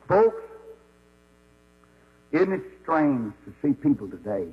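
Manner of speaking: ordinary talk, nothing marked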